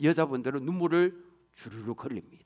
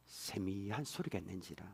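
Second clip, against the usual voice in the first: hushed reading; 10 semitones lower, 16 dB quieter than usual. Acted. Reading of the still small voice.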